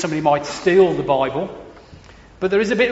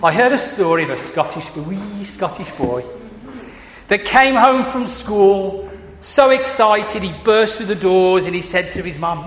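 ordinary talk, nothing marked

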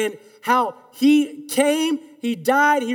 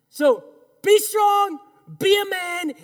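preaching emphasis; 8 semitones higher, slower than usual. Real, not acted. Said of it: "preaching emphasis".

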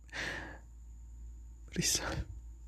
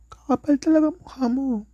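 Kakapal talaga mukha mo, much higher, stress on talaga; emphatic punchline; 10 semitones higher than usual. Real, not acted. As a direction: punch talaga high and falling.

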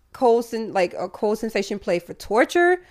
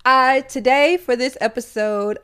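ordinary talk, nothing marked

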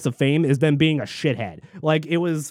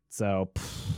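ordinary talk

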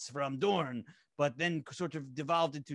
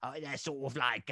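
Jimmy Butler voice. Retold or imitated. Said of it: "when he's like".

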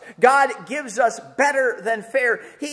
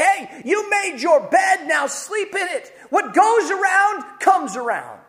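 mock stern judgment; 9 semitones higher than usual. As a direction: lay down the law, spelling out each phrase. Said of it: "You might expect him to say".

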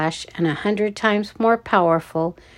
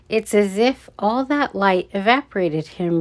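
plain reading voice

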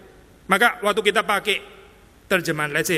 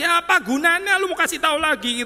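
much higher, fed-up voice; acting out Jesus losing patience with them; exasperated Jesus; 11 semitones higher, 3 dB louder than usual. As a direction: throw up your hands in exasperation. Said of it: "kesesekannya Yesus gitu ya".

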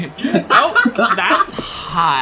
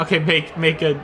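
ordinary delivery

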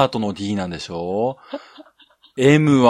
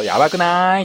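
gal voice; 7 semitones higher than usual. Retold or imitated. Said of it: "急にギャル現れたぞ".

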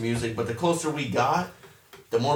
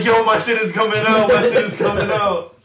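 higher, louder laughing mock panic; panicked yell; 8 semitones higher, 8 dB louder than usual. Gripping friends' seats on the loop, high panicked yell. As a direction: yell it while clinging on.

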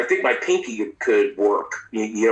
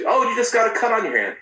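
high, quick mocking voice; mock dismissive staffer; 10 semitones higher, faster than usual. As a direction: mimic the brush-off, fast. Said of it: "No anesthesia, nothing".

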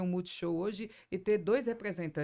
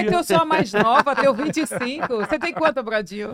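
excited; 5 semitones higher, 10 dB louder than usual. Real, not acted. Teasing about being youngest.

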